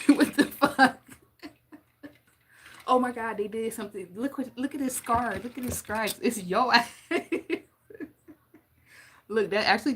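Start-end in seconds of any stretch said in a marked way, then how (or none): 0.0-1.1 louder, higher, laughing "what the fuck"; real laughing outburst; laughing disbelief. Real, not acted. 6.2-6.9 louder, laughing on the last word; amused teasing laugh; real giggle. Real, not acted. Laughing at scratch story.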